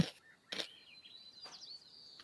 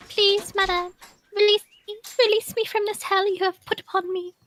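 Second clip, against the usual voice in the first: high dramatic begging pet voice; pleading cat voice; 11 semitones higher than usual. Pretend. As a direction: beg to be let out, hammed up. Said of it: "me voice acting and being loud".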